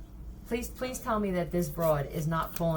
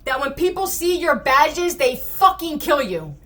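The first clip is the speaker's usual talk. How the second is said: much higher and louder, raging; enraged shout; 8 semitones higher, 12 dB louder than usual. Real, not acted. Yelling a curse at the police. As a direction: spit the wish out at full volume. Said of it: "Yes, I yell".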